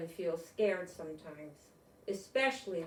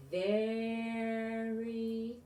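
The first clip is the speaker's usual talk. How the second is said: drawn out; 5 dB louder, much slower than usual. Acted. Reader to children, drawing out the snail's slowness. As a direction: stretch each word out long and slow.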